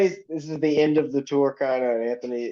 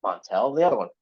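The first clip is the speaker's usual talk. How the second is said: ordinary delivery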